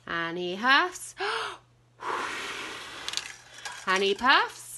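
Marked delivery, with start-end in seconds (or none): 2.0-3.3 big breathy huff; huffing breath. A narrator's reading. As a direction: blow out a wolf huff.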